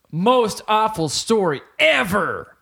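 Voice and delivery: slow, booming movie-trailer announcement; dramatic trailer voice. Pretend. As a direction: announce it like an epic title.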